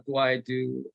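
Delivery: normal talking voice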